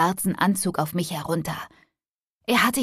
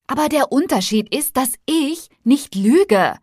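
a restrained yell over loud music, pitched up; yelling back; 6 semitones higher, 5 dB louder than usual. A narrator's reading. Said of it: "brüllte ich zurück, was unerlässlich war". (mit erhobener Stimme zurückrufen)

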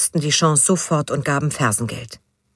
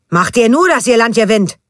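loud angry roar, pitched higher; 7 semitones higher, 7 dB louder than usual. A narrator's reading. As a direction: bellow at the boys to clear off. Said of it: "brüllte er".

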